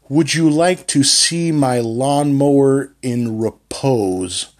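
slow, carefully enunciated reading; diction drill. Shown as a demonstration. Coach's drill sentence, slow over-enunciated reading. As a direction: lean on every consonant, slowly. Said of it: "he had me reading sentences like".

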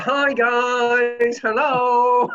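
high, loud, drawn-out hello; cheery video greeting from the dead. Acted. Dead man greeting his family by posted video, hammed-up and high. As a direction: sing out a loud mock greeting.

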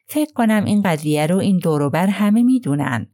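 usual speaking voice

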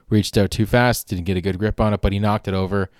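usual speaking voice

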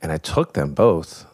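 ordinary talk

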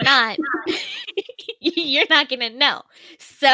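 loud teasing through laughter; laughing. Real, not acted. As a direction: tease while cracking up.

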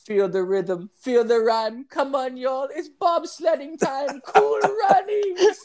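high singsong Jamaican chant; Jamaican accent. Retold or imitated. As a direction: chant it like a Cool Runnings bobsledder. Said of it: "they'd be like".

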